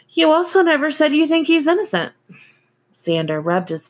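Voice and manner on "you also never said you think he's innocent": high, loud and fast; sharp retort. A narrator's reading. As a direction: fire it back at him.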